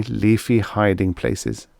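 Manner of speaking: ordinary delivery, nothing marked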